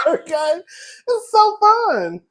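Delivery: high, slow, loud through laughter; laughing. Real, not acted. Spinning, laughing.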